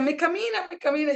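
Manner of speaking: high, frantic retold plea; panicked plea. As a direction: mimic a panicked patient. Patient panicking in retelling.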